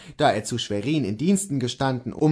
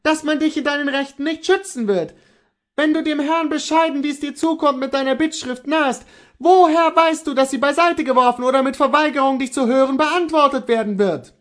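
high, loud, agitated wife; wild questioning; 8 dB louder than usual. Acted. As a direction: challenge your husband wildly, voice pitched high. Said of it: "fragte jene wild".

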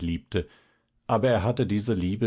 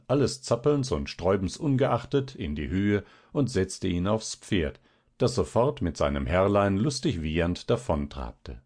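ordinary delivery

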